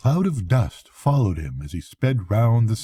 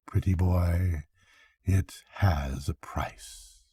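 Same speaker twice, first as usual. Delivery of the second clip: quiet; 3 semitones lower, 7 dB quieter, slower than usual. A narrator's reading. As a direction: drop to a soft, slow murmur. Witch teasing, trailing off.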